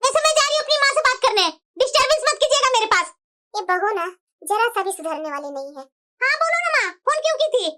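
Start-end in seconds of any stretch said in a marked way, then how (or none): 3.5-5.9 lower, softer muttered complaint; grumbling mother-in-law aside. Acted.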